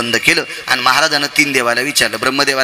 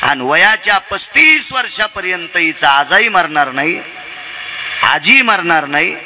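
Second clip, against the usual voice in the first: proclaimed divine verdict; 5 semitones higher, slower than usual. Acted. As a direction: pronounce a doom to the congregation, stretching the words. Preacher proclaims the gods' verdict. (कीर्तनकाराची संथ, ठासून केलेली घोषणा)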